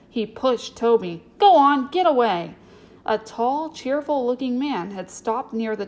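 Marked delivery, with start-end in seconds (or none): 1.4-2.5 loud, high angry dismissal; angry order. A narrator's reading. Boy shoves newcomer from the table, angry and loud. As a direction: drive him off with a raised voice.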